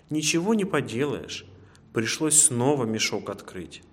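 usual speaking voice

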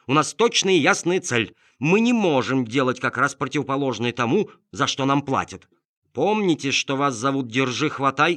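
loud, high telling-off; stern scolding. Acted. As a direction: put the helper in place. Detective scolds dog.

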